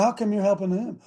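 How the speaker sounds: ordinary talk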